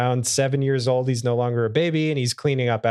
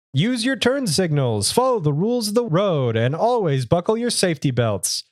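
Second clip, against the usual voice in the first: higher, hammed-up superhero delivery; Gohan's hero voice; 5 semitones higher than usual. Costumed hero lecturing reckless drivers, hammed-up hero voice. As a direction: proclaim it in a put-on hero voice, pitched up. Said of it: "in his hero voice".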